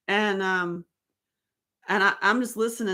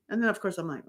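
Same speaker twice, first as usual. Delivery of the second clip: ordinary talk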